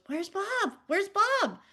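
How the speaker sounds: put-on high, urgent little-kid voice, lightly done; child's panicked question. Son asking for his lost dinosaur, high and urgent. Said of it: "Raleigh heard it and goes".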